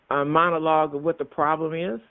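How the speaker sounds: ordinary talk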